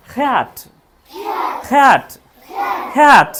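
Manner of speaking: कैट as "khat", with a breathy ख; aspirated English K. Shown as a demonstration. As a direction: puff of air on K, falling tune. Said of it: "native English ऐसा लगेगा".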